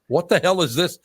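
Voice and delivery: startled. Retold or imitated. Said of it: "And I'm like".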